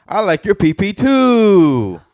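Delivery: put-on high, loud child voice; young son. Son to dad in Walmart, loud high child voice. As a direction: draw out the last word, loud and high. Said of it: "Then he goes".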